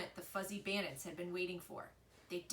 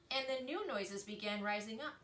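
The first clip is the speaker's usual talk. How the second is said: ordinary delivery, nothing marked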